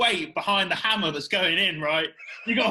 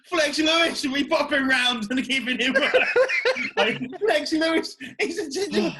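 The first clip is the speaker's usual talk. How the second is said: high, loud, cracking up mid-sentence; laughing; 8 semitones higher, 5 dB louder than usual. Real, not acted. Joking to friends about Flex Lewis popping round, laughing. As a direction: laugh helplessly through the words.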